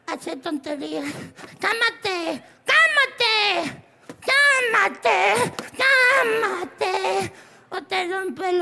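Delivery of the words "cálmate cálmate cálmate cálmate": hammed-up, stretched-out shouting; mock scolding shout. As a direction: escalate each cry theatrically. Clown mock-scolds, building each time.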